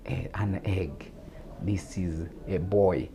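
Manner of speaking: ordinary delivery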